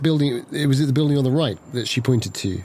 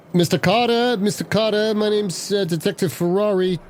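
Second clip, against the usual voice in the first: calling out; 8 semitones higher than usual. Acted. Calling out to hostage taker.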